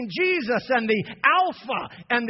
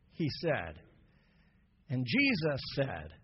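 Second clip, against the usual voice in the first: normal talking voice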